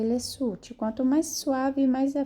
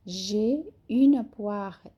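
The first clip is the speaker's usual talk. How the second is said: slow J'ai une poire with the final R of poire clearly sounded; slow teacher demo; slow model reading; much slower than usual. Shown as a demonstration. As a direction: model the sentence slowly for the class. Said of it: "O R é bem pronunciado da pera".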